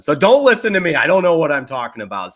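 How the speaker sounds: high, loud exclamation waving away your own words; loud self-mockery. Real, not acted. Host taking back his verdict that the season's over, loudly self-mocking.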